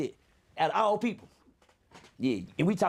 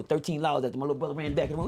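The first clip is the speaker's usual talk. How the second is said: normal talking voice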